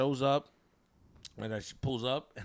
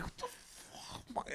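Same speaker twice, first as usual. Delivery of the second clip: voiceless, drawn-out whisper; stunned under-breath curse; 15 dB quieter, much slower than usual. Retold or imitated. Son in the car reacting to dad's insults, stunned slow whisper. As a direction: whisper it slowly in disbelief.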